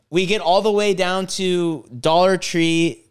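normal talking voice

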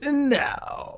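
high, drawn-out shout of no; high-pitched petulant no; petulant denial. Acted. Denying that she wins.

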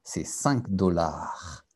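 normal talking voice

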